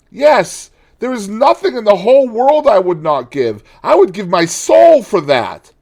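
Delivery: high, loud despairing cry; anguished lament. Acted. Dorian lamenting to the painter, high and anguished. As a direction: pour out his despair, pleading.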